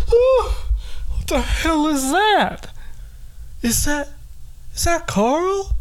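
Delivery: a frightened elder spotting a stranger; startled old voice. Retold or imitated. Old nursing-home resident spotting Carl, high startled voice. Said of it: "And it's like".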